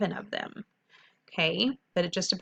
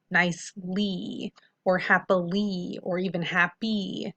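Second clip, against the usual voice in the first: slow demo word; slower than usual. Shown as a demonstration. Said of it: "see that".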